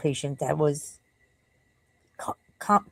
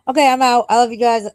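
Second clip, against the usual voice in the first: upbeat sign-off; 7 semitones higher, 8 dB louder than usual. Real, not acted. Signing off the stream.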